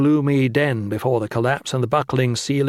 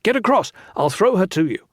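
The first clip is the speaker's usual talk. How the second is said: shouted order; 7 semitones higher than usual. A narrator's reading. Valin urging Lin across the gap, shouted. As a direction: yell instructions across. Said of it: "he shouted".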